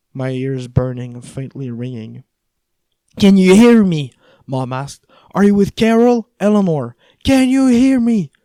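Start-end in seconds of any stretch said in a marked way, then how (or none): 3.2-4.1 high, very loud check on the line; shouting. A narrator's reading. Mom yelling over bad line. 5.3-8.3 high, loud volley of questions; shouting. A narrator's reading. Mom yelling over bad line.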